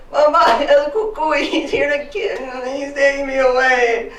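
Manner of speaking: high, loud frightened wailing; panicked child. Acted. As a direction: cry out as if being carried off. Acts terrified child crying for help.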